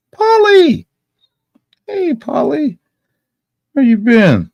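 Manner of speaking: very high, loud singsong call; high, excited holler of greeting. Real, not acted. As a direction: call out loudly in a high-pitched voice. Calling out to Polly.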